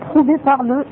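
usual speaking voice

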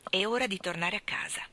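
ordinary delivery, nothing marked

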